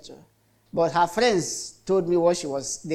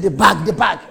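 louder insistent repetition of the bag; impatient nurse shout; 8 dB louder than usual. Retold or imitated. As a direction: insist loudly, losing patience. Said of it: "They said".

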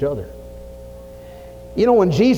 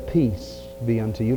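softer, lower tender greeting; gentle blessing; 6 semitones lower, 6 dB quieter than usual. Acted. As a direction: speak peace softly to frightened friends. Jesus greets the frightened disciples.

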